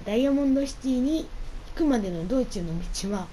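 ordinary talk, nothing marked

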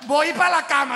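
loud, high mock royal announcement; old queen announcing bed. Queen announcing she is off to bed, loud mock voice. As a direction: declare it like a grand old lady. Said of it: "La vieja dijo".

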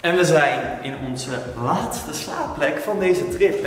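usual speaking voice

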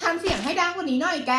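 loud, high-pitched call. Acted. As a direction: read the comment out as a loud, high demand.

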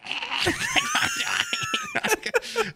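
shrieking high cackle; high cackling laugh. Retold or imitated. Grandma's cackle before scolding him, high and shrieking. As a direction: let out a wild high cackle.

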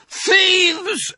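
very high, loud rat shriek. Acted. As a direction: scream it after the thieves. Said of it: "shrieked Rat".

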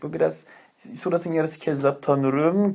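ordinary delivery

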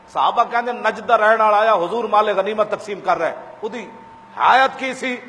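normal talking voice

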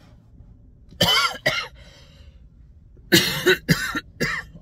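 loud, high hacking cough; harsh coughing fit. Real, not acted. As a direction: cough hard, rising and rasping. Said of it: "is my bronchitis back?".

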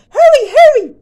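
loud, high urgent call. Acted. Grandmother calling squirrel home, loud and high. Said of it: "she called".

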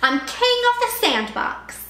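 high, loud boastful bully voice; bossy bully. A narrator's reading. Sammy claims the sandbox, boastful bully voice. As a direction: crow it like a little king. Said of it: "Sammy yelled".

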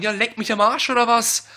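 loud furious cursing; yelling curses at the console; berserk rage. Real, not acted. Raging at failed download. (laut fluchend vor Wut)

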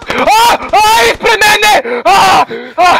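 high panicked shouts breaking into laughter; scared giddy laughing. Real, not acted. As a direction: scream in fright then laugh. Startled by enemy.

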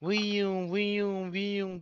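imitated ringing in the ears; ear ringing noise. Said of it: "kulağım çınlarmış gibi".

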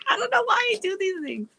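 very high, giggly squeal; helpless amusement. Real, not acted. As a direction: speak through real laughter at yourself. Laughing at her own goofiness.

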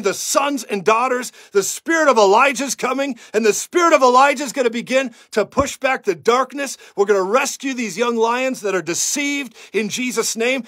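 high, loud preaching at full force; fiery prayer. Acted. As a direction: pray it out over the nation like a revival preacher. Prays and preaches over the nation.

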